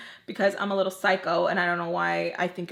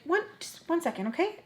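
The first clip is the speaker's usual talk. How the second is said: higher, softer mimicked aside; lightly voiced aunt; 8 semitones higher, 5 dB quieter than usual. Aunt stepping away to make a call, lightly mimicked high soft voice. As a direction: lightly imitate someone politely excusing herself. Acts aunt excusing herself.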